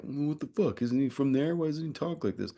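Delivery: mock-indignant questioning, voice jumps up; indignant viewer. Voices puzzled viewers objecting.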